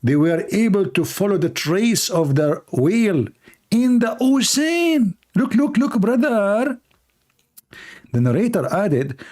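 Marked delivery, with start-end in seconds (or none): 3.7-6.8 mock amazement. Mock amazement at whale story.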